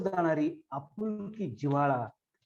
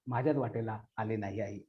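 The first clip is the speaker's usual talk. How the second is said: quiet, lower echo of the closing line; soft fading echo; 5 semitones lower, 6 dB quieter than usual. Acted. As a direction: drop the repeat to a quieter, lower close. Repeated closing line, echo take.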